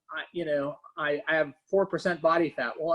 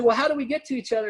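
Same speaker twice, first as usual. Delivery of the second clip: higher and fast, a playful puzzled question; mock puzzled question; 6 semitones higher, 3 dB louder, much faster than usual. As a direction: rattle it off like someone stuck across a gap. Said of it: "And they say".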